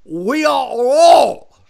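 drawn-out, high imitated scream; John Woo. Director screaming up the hill, loud accented impression. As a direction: bellow it slowly in the director's accent. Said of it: "John would scream from the bottom".

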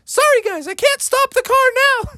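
high, rushed apology; apologetic mother. Acted. Mother Bear apologizes to the family while speeding, high and rushed. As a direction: blurt it fast and high. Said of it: "she's like".

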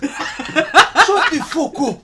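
slow, loud laughing shout; laughing protest. Real, not acted. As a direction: drag it out through laughter.

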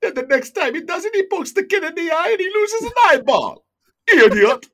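put-on shouted, high-pitched Jamaican accent; loud, hammed-up Jamaican accent; thick Jamaican accent. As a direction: imitate an irate Jamaican dad yelling from the stands. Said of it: "in his thick Jamaican accent".

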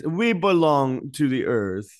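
slow, put-on British accent; mock King Charles accent. Host ridiculing the king's climate line in a slow fake British accent. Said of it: "the worst fake accent ever".

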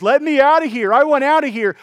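high, loud cry to get out; acted-out trapped yelling; desperate demand. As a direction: shout to be released from the heat. Clay begs to escape the kiln.

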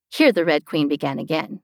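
ordinary delivery

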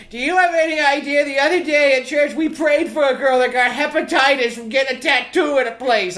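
high, lightly voiced worried mother; mock mother. Mother warning about hepatitis from tattoos, high mock scolding. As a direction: scold like a fretting church mom. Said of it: "She's like".

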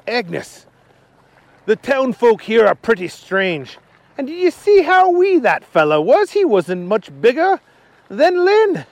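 lively storyteller voice for Archie; Archie's voice. Acted. Archie telling Agnes the townsfolk are strange, puzzled. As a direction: talk to your wife as Archie, puzzled.

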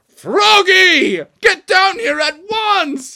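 high, very loud drawn-out yell; furious dad shout. Acted. Dad orders Froggy off the stage, furious shout. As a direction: holler the order with full force.

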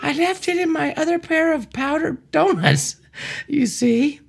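high nervous driver voice. Acted. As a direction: squeak it out like a flustered driver. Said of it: "Do different voices".

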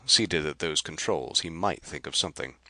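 ordinary delivery

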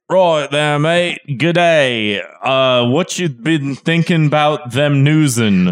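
slow, jumbled fake British accent with Australian bits; a bad mixed British-Australian accent, drawn out; mock British accent. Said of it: "That felt like five accents in one".

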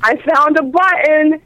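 imitated drunk. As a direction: draw it out like a tipsy discovery. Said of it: "he's supposed to be drunk".

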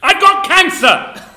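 loud, high, drawn-out shout; exasperated outburst. Acted. Host ending friends' pestering about the impression, exasperated shout. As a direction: blurt it out, stretching the words. Blurts excuse after being badgered.

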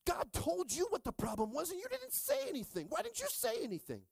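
hammed-up Eve blaming Adam; mock Eve nagging. Eve accusing Adam on the road out of Eden, high.

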